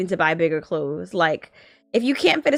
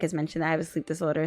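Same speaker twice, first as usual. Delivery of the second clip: normal talking voice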